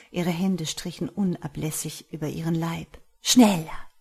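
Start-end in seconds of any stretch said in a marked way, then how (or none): 3.2-3.9 loud, imperious order; commanding. A narrator's reading. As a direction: order it sharply, expecting obedience. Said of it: "gebot sie".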